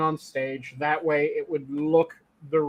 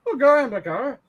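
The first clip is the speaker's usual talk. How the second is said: high-pitched County Cork Irish lilt; Corkish accent; 7 semitones higher than usual. Shown as a demonstration. Explaining what a Corkish accent is, mock Cork Irish. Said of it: "That is a Corkish accent".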